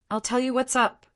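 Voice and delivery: mother lashing out, a touch venomous; spat. A narrator's reading. Mother on the phone to her daughter, mildly spitting anger. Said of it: "she spat".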